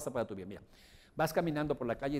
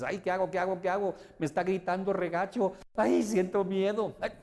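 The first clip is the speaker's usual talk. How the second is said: hammed-up panic, rising and louder; mock scared; 6 semitones higher, 4 dB louder than usual. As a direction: play the frightened victim, half joking. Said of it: "alguien muy agresivo contigo".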